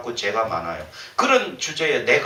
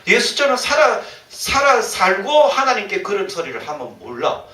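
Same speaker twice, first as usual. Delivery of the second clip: usual speaking voice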